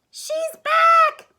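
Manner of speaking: loud, very high cry of dread; baby bear wail. A narrator's reading. Baby Bear finds Goldie, frightened high wail. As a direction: wail it in terror. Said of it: "he wailed".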